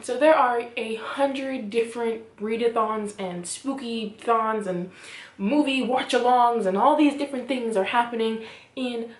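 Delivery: usual speaking voice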